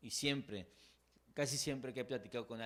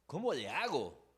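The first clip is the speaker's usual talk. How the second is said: desperate plea; 4 semitones higher, 7 dB louder than usual. Retold or imitated. Voicing a desperate question.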